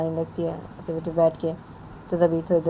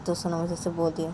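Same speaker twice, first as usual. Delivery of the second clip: plain reading voice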